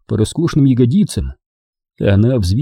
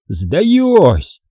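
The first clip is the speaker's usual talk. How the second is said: mock yell; 7 semitones higher than usual. A narrator's reading. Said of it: "завопил я".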